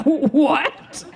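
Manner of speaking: high, rising disbelief; shocked mom. Retold or imitated.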